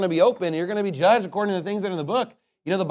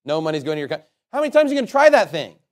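usual speaking voice

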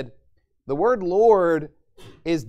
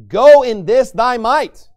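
loud, drawn-out command, pitched up; 6 semitones higher, 11 dB louder, slower than usual. Acted. The Lord sends Gideon out to fight, commanding. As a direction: proclaim it slowly as a divine order.

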